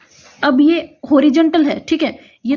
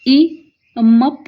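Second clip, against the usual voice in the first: ई and मप drawn out very slowly and louder; slow sounding out; 4 dB louder, much slower than usual. Shown as a demonstration. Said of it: "इसके लिए जो अव वाली आई ना". (ई, मप को खींचकर ज़ोर से बोलना)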